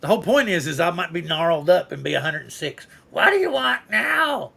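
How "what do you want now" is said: put-on cranky old-timer voice, slow; creaky grumpy old man asking. Old man voice imagined at 106.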